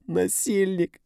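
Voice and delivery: woman shrieking an accusation; shrieked accusation. A narrator's reading. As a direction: scream it at him. Dina crying out.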